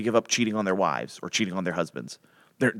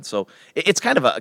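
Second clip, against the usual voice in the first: normal talking voice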